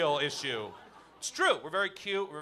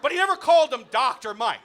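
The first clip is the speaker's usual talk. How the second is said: ordinary talk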